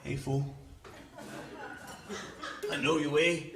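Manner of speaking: low, menacing gang guy. Retold or imitated.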